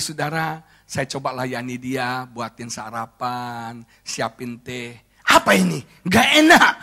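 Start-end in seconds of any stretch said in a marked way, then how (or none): 5.3-6.7 wife's disgusted complaint. Retold or imitated. Wife rejects his breakfast.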